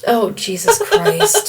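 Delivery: high slow laughing groan; exasperated laugh; laughing exasperation. Real, not acted.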